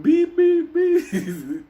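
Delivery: high monotone "beep" on a steady pulse; alarm clock beeping. Retold or imitated. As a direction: mimic an alarm clock, one even pitch. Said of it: "like a fucking alarm clock".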